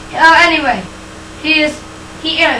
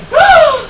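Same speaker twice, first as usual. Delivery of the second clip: high shocked gasp; 11 semitones higher than usual. Acted.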